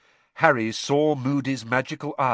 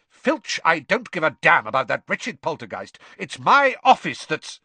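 angry; 5 semitones higher, 7 dB louder than usual. A narrator's reading. Snape snaps at Filch.

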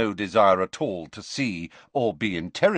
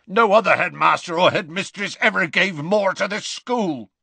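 loud, raised rough growl; 10 semitones higher, 5 dB louder than usual. Acted. Hagrid cutting in to defend Dumbledore, growling loudly. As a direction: growl it out in fierce loyalty. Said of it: "growled Hagrid".

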